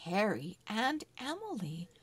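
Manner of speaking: ordinary delivery, nothing marked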